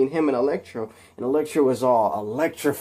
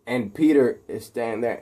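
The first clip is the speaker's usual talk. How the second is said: usual speaking voice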